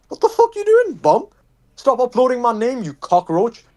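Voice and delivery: high-pitched mock rant at a rival; angry outburst. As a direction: snap at him like he's a pest. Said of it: "I was like".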